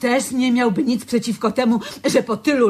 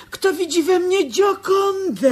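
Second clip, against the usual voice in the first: thrilled; 7 semitones higher than usual. Acted. Boasting of her new admirer.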